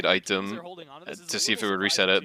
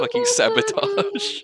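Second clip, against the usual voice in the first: drawn-out mock squeal, much higher and slow; slower than usual. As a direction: squeak the word out slowly in mock panic. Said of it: "She was like".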